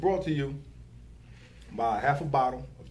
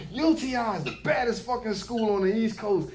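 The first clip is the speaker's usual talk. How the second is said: mock commercial pitchman; 8 semitones higher than usual. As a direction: sell it like a blustering car-lot ad. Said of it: "He'll start from behind the car and say".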